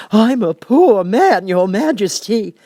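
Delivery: high, loud, slow quavering plea; trembling Hatter. A narrator's reading. Hatter pleads with the King, trembling high and slow. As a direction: beg for mercy, voice shaking.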